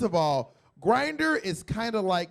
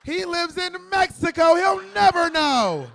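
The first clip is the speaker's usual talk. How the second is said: sassy drag queen; 12 semitones higher, 8 dB louder than usual. Real, not acted. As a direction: sell the bad advice loud. Conspiratorial advice to audience.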